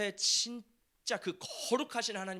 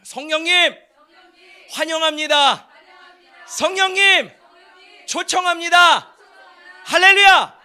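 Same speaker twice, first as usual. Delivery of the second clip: loud, high shouted invocation for the congregation to repeat; preaching call; 8 semitones higher, 18 dB louder than usual. Shown as a demonstration. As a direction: cry it out at full force, leading the room. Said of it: "따라해볼까요?". (회중을 이끄는 높고 큰 외침)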